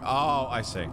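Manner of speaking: normal talking voice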